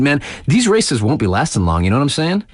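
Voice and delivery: normal talking voice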